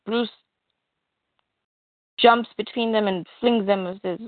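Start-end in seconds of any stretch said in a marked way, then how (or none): none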